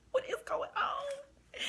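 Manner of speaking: very high, slow, bewildered squeak. Retold or imitated. Cashier watching manager and customer argue, high bewildered squeal. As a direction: draw it out high in mock disbelief.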